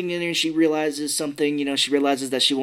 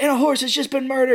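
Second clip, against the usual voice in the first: high, loud mock hysteria; hammed-up panicking reporter; freaking out; 12 semitones higher, 5 dB louder than usual. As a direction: report it as a dreadful tragedy. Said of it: "he starts freaking out".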